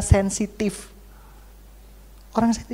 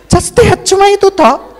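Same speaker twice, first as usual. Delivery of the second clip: much higher, louder laughing squeal; mock demanding girl; 12 semitones higher, 10 dB louder than usual. Girls wanting romantic melodrama mocked, laughing squeal.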